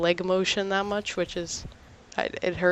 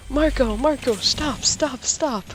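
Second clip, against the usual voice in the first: scolding brother; 7 semitones higher, slower than usual. Retold or imitated. Sister scolds her brother.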